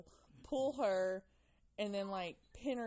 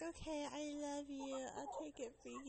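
put-on sweet little girl; 7 semitones higher, 5 dB quieter than usual. Child sweetly agreeing to medicine.

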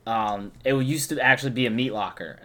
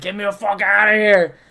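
high, loud plea for rescue; hammed-up cry for help; mock desperate yell; 8 semitones higher, 6 dB louder than usual. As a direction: imitate a friend hollering to escape. Imitating bandmate desperate to escape.

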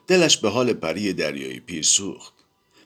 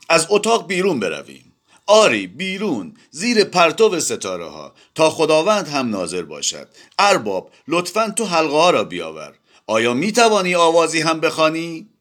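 loud, exclaiming; 11 semitones higher, 4 dB louder than usual. A narrator's reading. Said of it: "با صدای بلند گفت".

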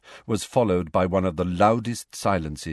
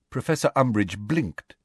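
ordinary delivery

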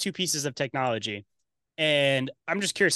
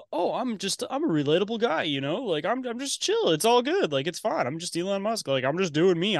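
mock relatable guy, pitched up; Elon Musk; 6 semitones higher than usual. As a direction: play at being harmless and chill, high and lilting. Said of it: "trying to play this relatable character".

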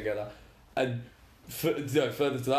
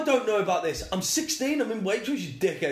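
put-on exasperated teen; 7 semitones higher than usual. Retold exasperation at customer.

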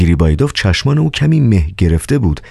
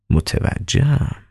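knowing; 9 semitones higher, 5 dB quieter, much slower than usual. Acted. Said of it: "دکتر این را گفت".

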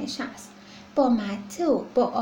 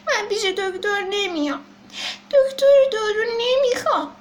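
high, louder tearful whine; crying refusal; 7 semitones higher, 4 dB louder than usual. Acted. Baby elephant crying to his mother that he refuses the doctor. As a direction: wail that you won't go.

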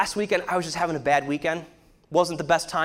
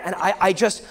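normal talking voice

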